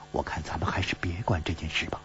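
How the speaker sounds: fast, whispered urging; quick soft whispered warning; hushed plea. Acted. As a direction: whisper it, begging him to drop it. Worried wife urging caution.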